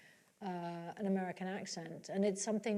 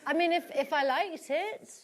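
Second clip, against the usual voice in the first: laughing; 6 semitones higher, 5 dB louder than usual. Real, not acted. Still amused after laughing.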